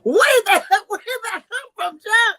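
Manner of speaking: very high, loud squeal; squealing mock voice. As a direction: shriek it in a put-on voice. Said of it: "I'm trying my accents out today".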